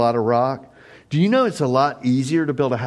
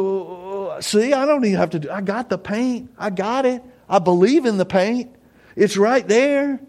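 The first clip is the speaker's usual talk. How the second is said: mock believer in paint; 9 semitones higher than usual. Man in garage boasting to Charlie, hammed-up smug. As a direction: play the silly man who thinks owning paint is enough. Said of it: "And Charlie go".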